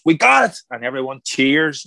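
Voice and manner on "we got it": much higher, louder wrap call; triumphant call. Retold or imitated. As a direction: announce the final take with relief. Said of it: "the director goes".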